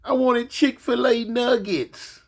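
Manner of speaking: put-on high, slow pleading kid voice; whiny child. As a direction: whine it like a spoiled boy. Grandson repeats.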